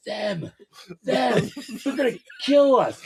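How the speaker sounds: high-pitched mock panic; imitated paranoia. Roommate pointing out the movers to him, imitated paranoid panic. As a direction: build from hushed to urgent, imitating someone terrified. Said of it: "getting all paranoid".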